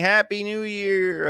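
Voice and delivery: slow, stretched-out Happy New Year; drawn-out celebration. Retold or imitated. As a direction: stretch the greeting out slowly. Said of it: "This is us saying".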